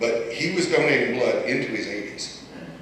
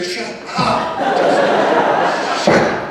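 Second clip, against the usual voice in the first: laughing through the words; teasing the late friend, laughing; 9 semitones higher, 7 dB louder, much slower than usual. Real, not acted. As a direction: giggle out a fond shut up.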